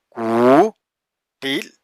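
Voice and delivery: കൂട്ടിൽ stretched very slowly; drawn out. Shown as a demonstration. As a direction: linger on each sound of കൂട്ടിൽ. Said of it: "slow syllable demo".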